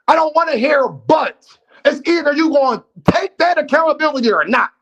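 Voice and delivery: insistent high-pitched fussing; yelling. Real, not acted. Host demanding accountability from Juke with no buts, yelling. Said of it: "when I'm yelling".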